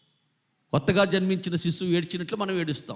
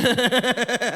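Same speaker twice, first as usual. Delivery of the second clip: imitated chuckling, rapid and repeated; mock laughter; 5 semitones higher, 4 dB louder than usual. Preacher mimics people watching Jabardasth, mock laughing. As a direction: put on a TV viewer's cackle. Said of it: "నవ్వుకుంటున్నారా".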